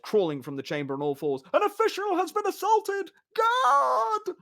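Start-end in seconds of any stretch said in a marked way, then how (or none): 1.5-4.4 very high, loud cry; official's yell. Acted. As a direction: cry for the guard. Official keeps crying for guard.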